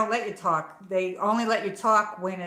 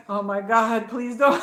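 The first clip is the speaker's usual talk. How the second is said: louder, laughing mock plea; mock pleading; 3 semitones higher, 5 dB louder than usual. To her viewers, begging not to be picked, laughing. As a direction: beg through a laugh.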